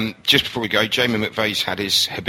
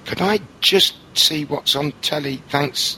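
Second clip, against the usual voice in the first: high, slow, sweetly asking; polite pleading voice; 8 semitones higher, slower than usual. Retold or imitated. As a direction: ask politely for the telly. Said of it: "so I'll be saying".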